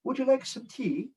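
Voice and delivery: high, soft imitation of children doing British; small, high mock-British voice; children's British accent. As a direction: echo it like a child trying British. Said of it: "They said".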